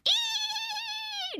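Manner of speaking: high chimp shriek; chimp squeal. Retold or imitated. Singing the animal song to chat, high chimp squeal. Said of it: "The chimpanzee says".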